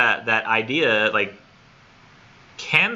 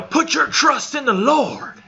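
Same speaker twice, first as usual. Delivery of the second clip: much higher, slow, hammed-up preacher yell; mock preaching shout drawn out slow; loud TV preacher; 4 dB louder, slower than usual. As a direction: belt it out like a televangelist on air. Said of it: "he's yelling real loud".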